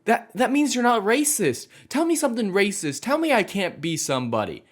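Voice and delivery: high, whiny mock kid voice demanding; hammed-up high girl voice insisting; mock little girl. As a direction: squeak it out like a bratty kid mocking her. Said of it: "this little girl goes to her teacher and is like".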